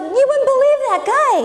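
fast, high, breathless news-telling; excited villager. Retold or imitated. Villager running to tell town, excited. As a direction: blurt it out like a villager who just saw a miracle.

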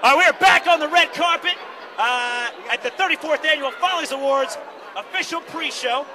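loud, eager awards host; red carpet host. Acted. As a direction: shout it over the crowd. Red carpet host pre-show.

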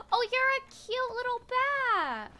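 high, loud and slow gushing over something cute; cooing delight. Real, not acted. Streamer gushing to a cute little bat in delight. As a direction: coo with delight at the little creature.

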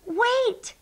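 very high urgent cry; alarmed shout. A narrator's reading. Rabbit warns the sheepdog off the bench, shouting high. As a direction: cry out to stop someone. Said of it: "shouted Beebe".

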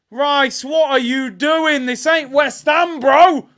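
loud, high and slow at first, telling him off; exasperated shout. Real, not acted. Fan yelling at Rice over a mistake. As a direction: berate him like he's forgotten his club.

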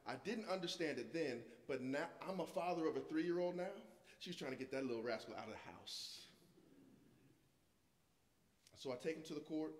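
usual speaking voice

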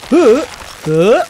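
surprised. Real, not acted. Startled, confused exclamations.

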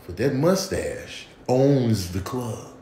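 normal talking voice

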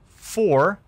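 ordinary talk, nothing marked

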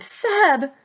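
high, loud cry of alarm; screamed name. A narrator's reading. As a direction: scream a warning to your brother. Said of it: "Ivy screamed".

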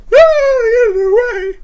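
very high slow loud squeal; squeaky shriek. Retold or imitated. Squealing character line.

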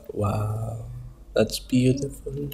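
normal talking voice

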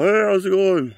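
a pushy stranger's bright hello; mock cheery stranger. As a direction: intrude with a loud cheery hello. Crowd mobs him on moon.

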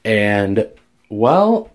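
usual speaking voice

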